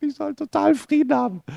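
high, airy voice for God's word; quoting God. Retold or imitated. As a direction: lift the voice high as if God speaks gently. God's word quoted in a story.

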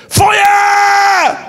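high, loud cry of fire; sudden loud, high shouted cry; retold shout. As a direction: yell it out like a man seized by fire. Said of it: "Dann schrie der".

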